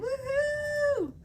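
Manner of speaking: high, bright woohoo cheer; excited cheer. Real, not acted. Host cheering Shelly's notification to the chat, excited whoop.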